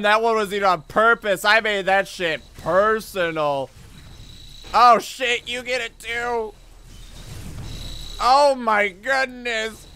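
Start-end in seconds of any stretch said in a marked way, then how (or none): 4.7-9.9 flustered exasperation. Real, not acted. Streamer fleeing the boss with the baby, flustered. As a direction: beg to just escape.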